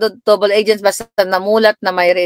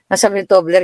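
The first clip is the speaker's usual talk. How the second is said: normal talking voice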